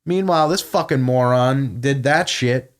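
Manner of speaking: normal talking voice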